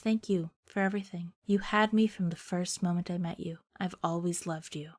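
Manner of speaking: hushed declaration of love; tender farewell. Acted. Joshua's last declaration of love.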